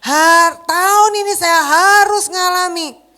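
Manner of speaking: loud slow preaching; preaching declaration. Acted. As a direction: declare it boldly to the church. (mengkhotbahkan dengan lantang dan lambat)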